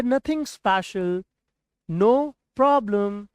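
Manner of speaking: very slow, drawn out; slow model phrases. Shown as a demonstration. Teacher slowly models calm replies to worried parents. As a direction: stretch every word for learners. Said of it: "आप English में क्या बोलेंगे?".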